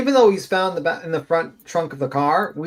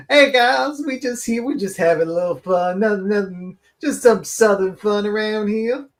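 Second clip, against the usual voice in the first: put-on sweet Southern accent; 5 semitones higher than usual. Mocking the streamer's sweet Southern accent for her viewers. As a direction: coo like a Southern hostess. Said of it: "she gets back to".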